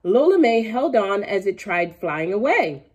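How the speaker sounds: usual speaking voice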